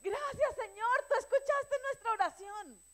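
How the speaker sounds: alto y agudo, alabanza eufórica; da gracias con júbilo exagerado; agradecimiento exaltado. Retold or imitated. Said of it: "él esperaba que dijera". (gracias entusiastas y chillonas)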